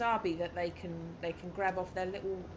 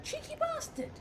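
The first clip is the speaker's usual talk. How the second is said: playful squeaky insult. Pretend.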